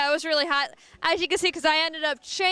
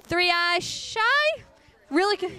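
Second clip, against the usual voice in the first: ordinary talk, nothing marked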